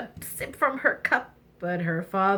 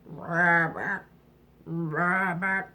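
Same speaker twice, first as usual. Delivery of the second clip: frog croak; 4 semitones lower, much slower than usual. Acted.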